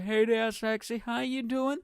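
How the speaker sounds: high, drawn-out flirty call; slow, high pick-up line; catcall.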